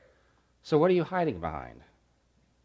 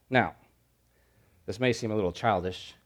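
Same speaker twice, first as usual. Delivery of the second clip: usual speaking voice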